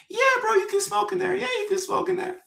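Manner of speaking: playful squeaky falsetto, way up high; mock high voice. To a stranger who thinks he works there, goofy high falsetto. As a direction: toy with him in a silly high voice. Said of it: "I was like".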